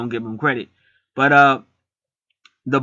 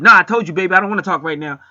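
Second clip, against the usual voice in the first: loud brush-off; 6 semitones higher, 6 dB louder, faster than usual. Retold or imitated. Boyfriend snapping at girlfriend.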